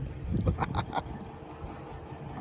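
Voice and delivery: quiet breathy chuckle; hushed amused laugh; soft laugh. Real, not acted. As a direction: laugh softly to yourself. Laughs at plan to paint.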